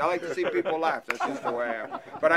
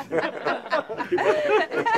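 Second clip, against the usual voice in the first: high breathy laugh; 12 semitones higher, 3 dB louder than usual. Real, not acted. Guide laughing at wife joke.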